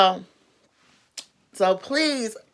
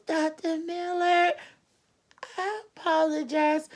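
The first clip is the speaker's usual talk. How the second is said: meek apology; 7 semitones higher, 9 dB quieter than usual. Retold or imitated. The queen apologizing to the doctor.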